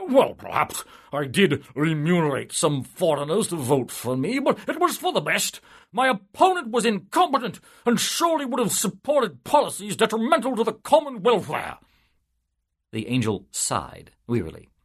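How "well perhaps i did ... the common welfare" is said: high, loud self-important politician; higher, louder pompous official voice; pompous civil servant. A narrator's reading. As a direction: puff up and boast of your stellar record. Soul justifies rigged election.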